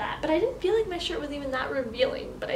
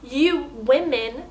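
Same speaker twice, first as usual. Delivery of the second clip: sarcastic reading; 6 dB louder, much slower than usual. Retold or imitated. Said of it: "This person wrote".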